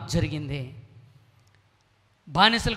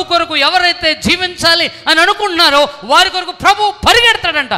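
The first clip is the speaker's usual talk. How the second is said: loud, high, driving sermon voice; fervent preaching; 7 semitones higher, 11 dB louder than usual. Acted. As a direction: preach it out to the whole church. Preacher at full force opening. (ఉత్సాహంగా బిగ్గరగా ప్రసంగం)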